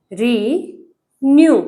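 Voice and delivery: Renew very slowly, री and new held apart; teacher sounding out renew piece by piece; renew syllable by syllable. Shown as a demonstration. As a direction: break renew in two with a long pause after री. Teacher splits word into syllables.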